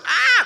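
very high, loud shriek; mock-terrified squeal like little girls; little-girl scream. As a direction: scream in comic panic, high and piercing. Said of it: "they're screaming like little girls".

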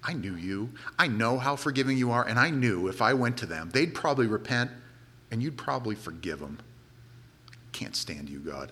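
a sulky prophet grumbling at God, softly; sulky grumble. Retold or imitated. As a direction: grumble it under your breath, sulking. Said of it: "he's like".

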